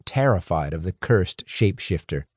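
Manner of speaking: ordinary delivery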